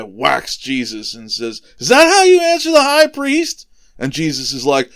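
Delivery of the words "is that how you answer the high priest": high, loud scolding question; acted shout of reproach; outraged rebuke. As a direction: play an officer indignantly reprimanding a prisoner. Officer rebukes Jesus after hitting him.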